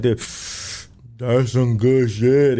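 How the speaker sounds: slow, drawn-out mock stoner; lightly voiced deep, dragging dazed voice; deep stoned voice. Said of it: "You get that deep voice".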